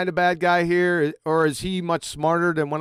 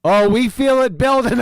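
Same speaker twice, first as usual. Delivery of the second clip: louder, sneering echo of the caller's words; mocking echo; 3 semitones higher, 5 dB louder than usual. Mocking the caller's own phrase, sneering echo. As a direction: throw his line back at him with a sneer.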